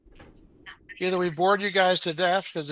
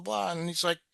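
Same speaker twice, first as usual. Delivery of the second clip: usual speaking voice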